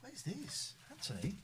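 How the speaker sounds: ordinary talk, nothing marked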